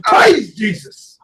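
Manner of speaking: drawn-out, high, loud "Praise Jesus" like a churchgoer caught in the spirit; slow, hammed-up hallelujah cry; mock preacher. Mocking holy ghost church folk.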